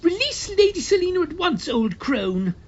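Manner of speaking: high, loud, commanding shout; high boyish voice shouting a demand. A narrator's reading. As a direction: order her to release the lady. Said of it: "in a loud voice, demanded".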